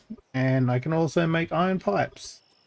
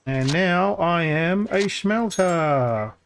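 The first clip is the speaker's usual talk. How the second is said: proud announcement; 5 semitones higher, much slower than usual. Real, not acted. Proud announcement after unlocking.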